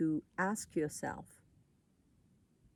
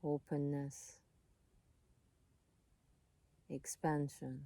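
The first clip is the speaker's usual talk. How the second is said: calm, unhurried voice with long pauses; soothing meditation guide, leaving space between phrases; guided meditation; 5 dB quieter than usual. Real, not acted.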